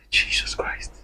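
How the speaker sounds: whispered, barely audible exclamation; appalled whisper; hushed dismay. Real, not acted. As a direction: breathe it out in a near-silent whisper. Horrified aside after retelling a plea.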